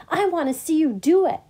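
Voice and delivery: high, loud, quick and eager; excited. A narrator's reading. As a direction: rush it out with keen anticipation. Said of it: "the pollywog said excitedly".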